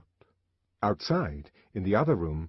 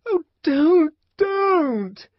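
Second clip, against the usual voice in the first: much higher, louder crying protest; tremulous crying plea; 10 dB louder, much slower than usual. A narrator's reading. Lenina to the savage shaking her, tremulous crying plea. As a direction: cry out as you are shaken. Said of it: "in a voice made grotesquely tremulous by his shaking".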